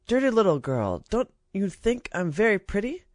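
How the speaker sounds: put-on high, preening little girl's voice; vain princess. A narrator's reading. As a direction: show off to the ragged child.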